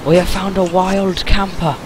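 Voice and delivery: hushed wildlife narrator; nature documentary. Pretend. Spotting wild campers in game.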